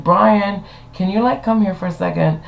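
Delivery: high-pitched summons; calling out. Retold or imitated. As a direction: call someone over, voice lifted. Calling the stage manager over.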